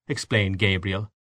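usual speaking voice

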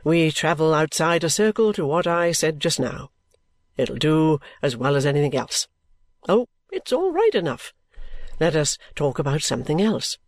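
low character voice with a light lift. A narrator's reading. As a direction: breezily dismiss it in his low voice.

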